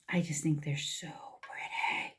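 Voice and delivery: whispered with a little laugh. Real, not acted. Makeup vlogger whispering to viewers about her shimmers. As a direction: breathe it out in a giggly whisper.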